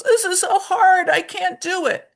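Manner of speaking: put-on high, loud whine of a frustrated kid; mock child wail; whiny child. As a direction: act out the child who gives up. Teacher voicing a frustrated child.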